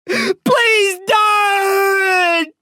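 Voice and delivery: long, high wail of pain; tearful howl. A narrator's reading. Narrator begs as the knife digs in, howling through tears. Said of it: "I howl through tears".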